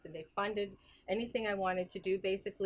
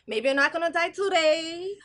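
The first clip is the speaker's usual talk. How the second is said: fortune teller; 8 semitones higher, 10 dB louder than usual. Acted. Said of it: "I'm a fortune teller".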